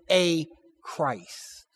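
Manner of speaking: A set apart and emphasized before Christ; stress on A. Real, not acted. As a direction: slowly, lean on the word A. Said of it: "Note the emphasis on a Christ".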